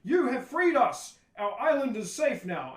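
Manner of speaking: loud, high, slow stage proclamation; rejoicing tribespeople. Acted. Tribe hails the heroes who freed their island, rejoicing proclamation. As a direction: proclaim it like hammy freed villagers. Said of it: "the tribe comes back and goes".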